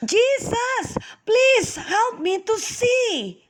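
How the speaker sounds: high, slow crying request; tearful plea. Acted. Blind man answers Jesus, pleading with tears. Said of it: "Bartimaeus quickly answered Jesus".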